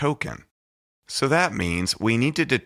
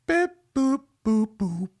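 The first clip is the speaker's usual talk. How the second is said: high, squeaky robot beep-boop noises; 13 semitones higher than usual. Pretend. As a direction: play a little computer crunching numbers. Imitating computer during multiplication.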